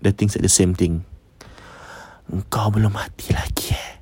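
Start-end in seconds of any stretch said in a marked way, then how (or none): 2.3-4.0 soft, breathless ghostly whisper; eerie whisper. Retold or imitated. The mystery caller whispering to the husband, eerie and soft. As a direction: hush it like something from beyond.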